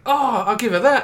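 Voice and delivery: high, pleased little realisation; acted bright idea. Child spotting a coin for teacher's gift, acted eagerness. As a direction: play a child hitting on a solution. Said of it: "I think".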